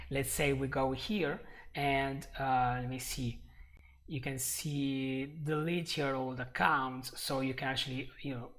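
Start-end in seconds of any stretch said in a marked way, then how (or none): none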